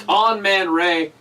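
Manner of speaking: loud, stretched-out ring announcer call. Acted. Announcing wrestler name.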